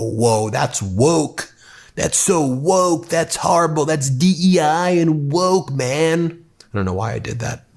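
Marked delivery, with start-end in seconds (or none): 0.0-6.4 a put-on outraged pundit, hammed-up; mocking right-wing pundit voice. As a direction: lampoon the Fox-style outrage over woke things. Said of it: "that, that voice".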